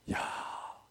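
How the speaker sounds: usual speaking voice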